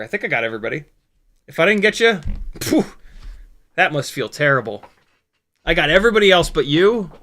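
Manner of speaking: ordinary talk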